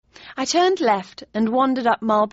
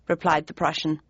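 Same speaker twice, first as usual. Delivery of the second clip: usual speaking voice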